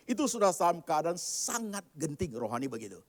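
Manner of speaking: normal talking voice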